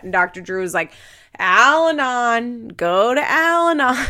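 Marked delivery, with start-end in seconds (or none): 1.4-4.1 loud, drawn-out mock command; emphatic insistence. Doctor Drew urging Tyler to go to Al-Anon, mock emphatic. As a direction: hammer the word home like a fed-up doctor. Imitating Doctor Drew's blunt advice.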